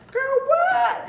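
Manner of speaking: very high, loud mock surprise; put-on innocent shock; fake clueless. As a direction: play dumb with a shrieked "what". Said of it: "acting calm and clueless".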